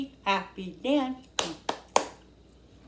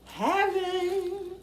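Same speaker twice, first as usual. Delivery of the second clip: heaven drawn out, much higher; high ecstatic heaven; 12 semitones higher than usual. Real, not acted. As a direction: float it up high in bliss. Tasting food, then sings heaven.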